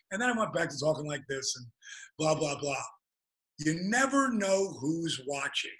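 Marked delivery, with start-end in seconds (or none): none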